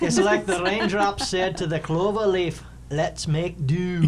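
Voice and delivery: put-on loud, high Irish-accented pickup line. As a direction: deliver a hammed-up pickup line in a big Irish brogue. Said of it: "In the Irish accent?".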